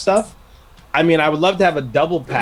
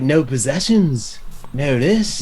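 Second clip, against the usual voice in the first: put-on drawn-out "No possessions" with a slight accent; much slower than usual. Recalling the Forrest Gump scene, slow put-on [name] accent. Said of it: "you can really get his accent down".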